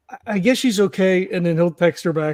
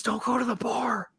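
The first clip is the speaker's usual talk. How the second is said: higher, hushed stage whisper; loud whisper; 4 semitones higher, 7 dB quieter than usual. Acted. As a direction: whisper a warning from up close. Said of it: "like, loud whispers".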